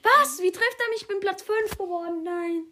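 high, loud cry of disbelief; real shock at being hit; shocked outburst. Real, not acted. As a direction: yelp of surprise, then an indignant question. Suddenly hit while invisible in game.

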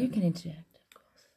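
hushed whisper aside. Acted. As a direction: whisper it softly. Mock hushed reply.

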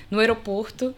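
usual speaking voice

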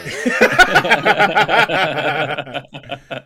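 long, loud, high laughing fit; helpless burst of laughter; big belly laugh. Real, not acted. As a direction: crack up loudly at the joke. Laughing at the memory gag.

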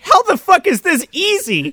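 high, incredulous exclamation; mock disbelief. Viewer reacting to the killer's line, high disbelief. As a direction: throw the question back in disbelief. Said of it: "And I'm like".

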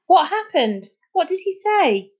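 high-pitched urgent questions; eager questioning. Acted. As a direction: press a friend for news. Friends eagerly question the boy.

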